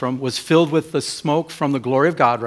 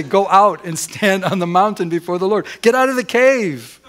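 raised, higher voice, building to a shout; acted divine command, louder at the end; commanding call; 5 semitones higher, 4 dB louder than usual. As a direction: order someone out, forcefully. Preacher voices God's command to Elijah.

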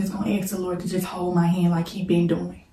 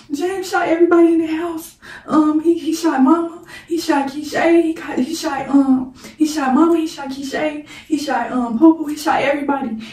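imitating the crying sister much higher and louder, breathless list; high, loud panicked outpouring; hysterical sister; 9 semitones higher, 6 dB louder than usual. Sister screaming the news down the phone, hysterical and high. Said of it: "she crying".

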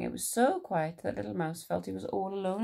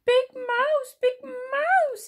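very high, loud squeak; calling Little Mouse; 8 dB louder, much slower than usual. A narrator's reading. Little Mouse wakes Big Mouse, high urgent calling. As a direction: squeal the name to wake someone. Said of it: "he said".

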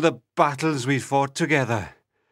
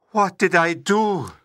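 angry cry; 4 dB louder than usual. A narrator's reading. Prince realising his own mistake, angry cry. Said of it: "he cried angrily".

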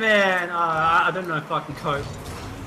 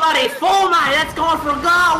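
higher, worked-up Australian banter; Aussie accent; 6 semitones higher, 3 dB louder than usual. Pretend. Player scolding mate over a goal, worked-up Australian accent. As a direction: berate your mate in broad Aussie. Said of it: "Aussie slang".

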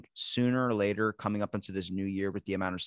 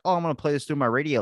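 carefree excitement; 5 semitones higher, 5 dB louder, faster than usual. Retold or imitated. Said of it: "thinking like".

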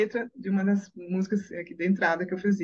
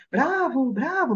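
higher-pitched imitated bravo cheer; cheering audience; 7 semitones higher, slower than usual. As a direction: mimic a crowd calling bravo. Imagined audience cheering a singer.

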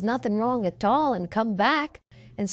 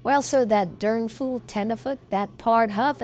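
ordinary delivery

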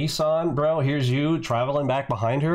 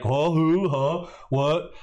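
mock feigned ignorance; 4 semitones higher, slower than usual. Host mimics rival pretending not to know him. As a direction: pretend to be clueless, hammed-up a little. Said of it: "acting like".

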